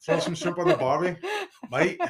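a hammed-up but weak Australian accent; cliché Australian line, lightly voiced; bad Australian accent. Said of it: "like, an Australian".

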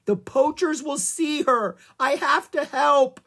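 high, loud, slow pleading; desperate insistence. A narrator's reading. Annie desperate to save calf.

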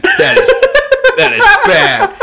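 very high, breaking into laughter; laughing fake disgust. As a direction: act disgusted but crack up.

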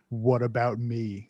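low, slow line delivery; Stallone. Retold or imitated. Friend mimics the movie's out-of-nowhere line, in a low slow Stallone voice. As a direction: drop it low and draw it out, slowly. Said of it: "and then Stallone goes".

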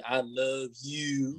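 soft, slow imitation Baltimore accent; mock Baltimore drawl on "you". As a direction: stretch "you" like someone from Baltimore. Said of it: "I would love to hear a Baltimore accent".

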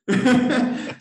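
loud high real laughter; burst of laughter. Real, not acted. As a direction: crack up loudly at the absurd story. Laughing at the doctor's reply.

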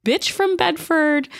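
high-pitched, slowed ranting outburst; mock tirade. A woman unloading on her boyfriend's family, high mock tirade. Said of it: "she just goes on this whole tirade".

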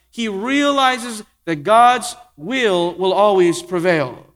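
slow emphatic. Acted. Preacher drives home main point.

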